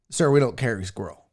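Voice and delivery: politely turning down an odd request; calm store clerk. Retold or imitated. Clerk answering tribesman at store.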